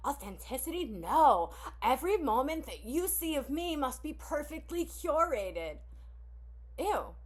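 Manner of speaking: high, lightly voiced prissy snob; snobby influencer. Mocking people who judge oversharing, lightly prissy. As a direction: sneer at authenticity like a fake influencer.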